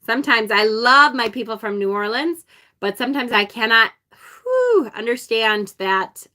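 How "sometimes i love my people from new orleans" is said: ordinary delivery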